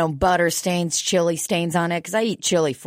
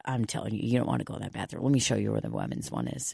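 low, hushed, much softer woman's warning; lowered secretive voice; quiet warning; 9 semitones lower, 10 dB quieter, faster than usual. Retold or imitated. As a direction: confide the danger in a low murmur. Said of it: "She goes".

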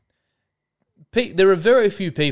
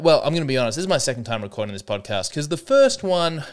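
ordinary talk, nothing marked

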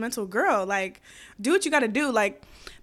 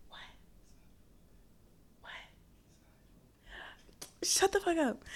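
hushed whisper, higher; 7 semitones higher, 10 dB quieter than usual. Real, not acted. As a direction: whisper it aside, playfully. Whispered aside to boyfriend.

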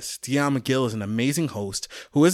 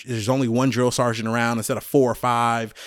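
ordinary delivery

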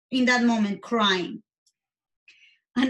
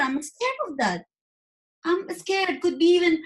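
raised pitch, a touch of retold fear; retold fearful confession; 6 semitones higher than usual. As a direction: relive telling mom, voice climbing higher.

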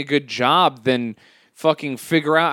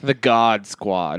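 mocking disdain; 9 semitones lower, much slower than usual. Mocking the group's name.

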